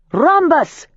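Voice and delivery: high, ringing shout; casting a circle at full voice; shouted spell word. A narrator's reading. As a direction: cry out the spell. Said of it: "I shouted".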